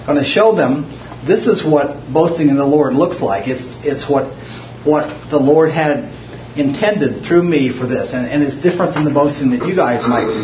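ordinary delivery

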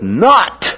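"not" punched out high; emphatic NOT. Acted. Peter leads his denial with "not", emphatic and high. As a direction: hit "not" hard, jumping up in pitch. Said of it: "So he's emphatic using that".

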